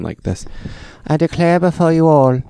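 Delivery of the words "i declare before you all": put-on high, projected young royal voice; Queen Elizabeth. Shown as a demonstration. Host impersonating the young queen's address, high regal British voice. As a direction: declare it as a young British monarch. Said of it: "Queen Elizabeth when she was twenty-seven years old talking".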